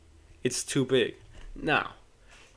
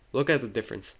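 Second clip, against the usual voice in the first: usual speaking voice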